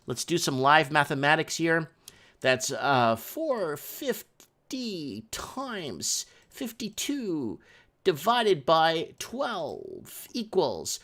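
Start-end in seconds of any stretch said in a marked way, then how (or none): 3.2-11.0 slowly reading out the sums; slow calculator readout. Real, not acted. Typing into the calculator, reading it out slowly. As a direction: spell out each number as you key it.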